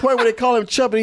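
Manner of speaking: usual speaking voice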